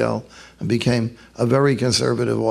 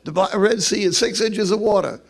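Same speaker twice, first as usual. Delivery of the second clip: lightly voiced smart-aleck boy, higher; smart aleck son; 6 semitones higher than usual. Son tells his reverend father about Moses, smart aleck. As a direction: pipe up higher, a hint of a know-it-all son. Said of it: "his smart aleck son".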